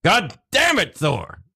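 high frustrated curse; exasperated outburst. Acted. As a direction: blurt it out in exasperation. Frank swears at Thor.

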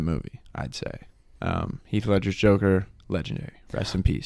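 soft, solemn tribute; hushed. Real, not acted. Solemn tribute to Heath Ledger.

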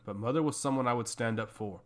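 usual speaking voice

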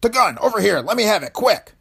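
loud, high-pitched frantic shout; screamed order. Acted. Gunfight henchman yelling for the gun, screamed order. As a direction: scream it as an urgent command. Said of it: "A man's voice close behind him screamed an order".

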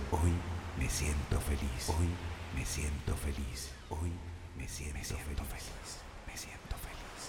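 distant, very soft repeat of an affirmation; fading echo. Acted. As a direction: let each repeat recede further away.

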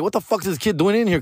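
lightly voiced waiter, baffled; annoyed waiters. Waiters spotting a kid in the bar, mild mock puzzlement. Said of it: "the meseros were like".

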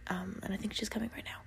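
hushed whisper, dog approaching. Real, not acted. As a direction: whisper it so the dog won't hear. Dog coming down the stairs.

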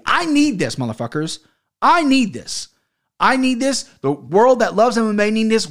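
heated rant, pitched high and forceful; fired-up, emphatic outburst; worked up. Real, not acted. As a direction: plead your case with real exasperation. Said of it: "I got a little worked up there".

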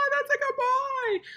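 put-on very high, slow, sing-song "that's a good boy"; high praise voice. Owner praising the dog too early, high and slow. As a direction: gush at the dog in a high sweet voice. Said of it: "you're gonna be like".

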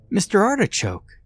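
put-on high delighted little boy; child exclaiming. Son thrilled with his stuffed giraffe, high excited child. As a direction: squeal it out like a happy kid. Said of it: "Benjamin exclaimed".

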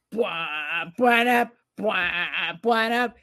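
hummed brassy bwah stings; mimicked theme sting. Retelling the movie's opening, vocal brass stings. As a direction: imitate the old show's theme music.

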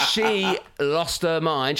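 ordinary talk

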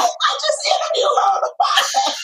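a comic shriek at the absurdity; mock exasperated squeal. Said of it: "I'm like".

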